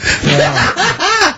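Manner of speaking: a shrieking high cackle of laughter; helpless high-pitched laugh. Real, not acted. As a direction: burst into a squealing laugh. Reacting to Carl's joke.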